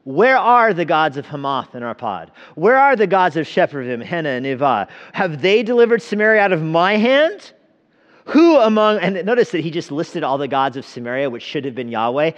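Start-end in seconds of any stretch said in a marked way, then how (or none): 0.0-9.0 high, loud challenging call; loud taunt. A narrator's reading. Envoy taunts Jerusalem about its gods, loud and high. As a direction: throw the question up at the walls.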